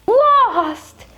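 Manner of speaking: high, loud cry of dismay; exclaiming. Acted. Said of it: "exclaimed poor John".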